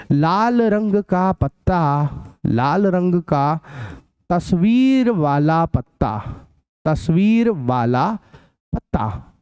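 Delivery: slow, word by word, so students can write it; slow dictation. Shown as a demonstration. Teacher dictating the question to the class, slow and spaced. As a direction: dictate each chunk slowly with pauses.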